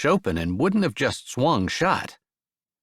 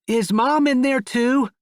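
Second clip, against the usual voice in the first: higher, slow, a touch of fear; timid child; 13 semitones higher, 4 dB louder than usual. Acted. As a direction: ask nervously through the door. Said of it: "a little bit of fear, or timidness maybe, in his voice".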